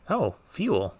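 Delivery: usual speaking voice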